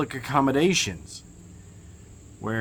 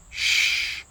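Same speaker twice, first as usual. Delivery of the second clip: whispered shush like a librarian. Shown as a demonstration. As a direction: shush them like a stern librarian. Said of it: "I always wanted to say that like a librarian".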